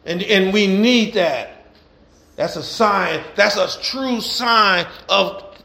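rhythmic preacher cadence, building; preaching. Acted. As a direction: preach it to the congregation with fire. Preaching to congregation.

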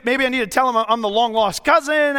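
usual speaking voice

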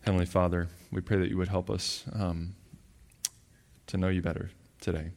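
soft, reverent spoken prayer. Acted. Leads the congregation in prayer.

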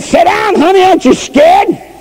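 put-on high, strained cry to the child; a panicked old lady pleading; old woman begging the little girl to sit down, frantic; scared old woman.

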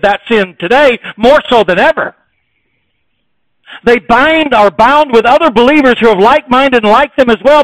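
loud, high, driving sermon delivery; preaching at full force. Acted. Preacher rousing his church about loving all believers, loud and fervent. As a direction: hammer every line home to the congregation.

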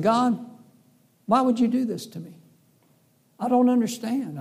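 pity party. Retold or imitated. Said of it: "I had a little pity party".

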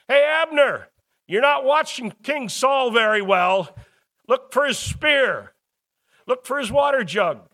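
loud, high taunting call across a distance; hollering. Acted. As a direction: holler it over to the other camp. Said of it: "he hollers back".

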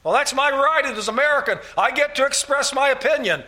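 high, loud, huffy protest; mock indignant objector. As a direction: play the offended American defending his rights.

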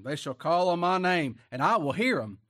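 high, loud preacher's cry; fiery preaching. Acted. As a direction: thunder it from the pulpit. Loud scripture proclamation.

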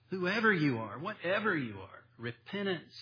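usual speaking voice

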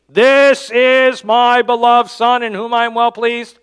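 loud, proclaiming God's voice; booming voice from heaven. Retold or imitated. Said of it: "It's obviously God speaking".

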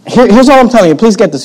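ordinary talk

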